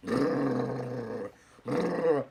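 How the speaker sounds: imitated revving chainsaw, rolled brrr; chainsaw noise. Man comes out with a chainsaw, imitated revving. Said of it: "he comes out with a chainsaw".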